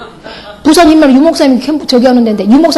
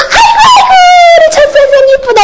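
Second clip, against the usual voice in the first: put-on delighted old lady. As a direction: squeal it like a doting grandmother. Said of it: "그거 해주면".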